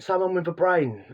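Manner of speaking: a quick Brummie quip, lightly done; a Birmingham accent, half-hearted and amused. Retold or imitated. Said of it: "in his Brummie accent".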